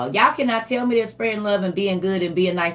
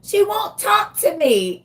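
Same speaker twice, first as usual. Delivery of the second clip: put-on whiny little kid; 13 semitones higher, 4 dB louder than usual. Host mimicking a grandbaby demanding to talk to her. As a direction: imitate a small child begging for her auntie. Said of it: "be yelling at they mama's phones".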